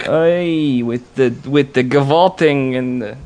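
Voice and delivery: put-on lightly done Yiddish-tinged Brooklyn accent.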